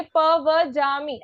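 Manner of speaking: पवज्जामि as pa-va-jaa-mi; पवज्जामि with no doubled consonants; single व and ज. Shown as a demonstration. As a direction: sound just one v and one j in पवज्जामि. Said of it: "सिर्फ एक व और एक ज हो रहा है".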